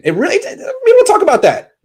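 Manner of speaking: very high, indignant squeak; a sudden high squeak of protest; squeaky outrage. Real, not acted. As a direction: let the voice jump up in disbelief. Rant peaks.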